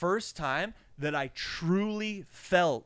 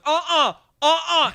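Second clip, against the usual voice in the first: loud, higher uh-uhs; defensive driver; 10 semitones higher, 9 dB louder than usual. Retold or imitated. Driver getting worked up, a loud uh-uh. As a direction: refuse loudly. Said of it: "And he goes".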